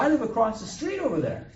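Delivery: high, loud explanation; high, loud storytelling protest; acted protest. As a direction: insist loudly, pitched well up.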